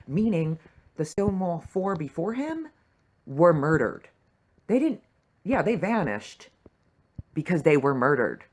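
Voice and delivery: loud, high, indignant insistence; indignant fan raising her voice; outraged emphasis. Real, not acted. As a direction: hammer home the murder. Outraged realization about murders.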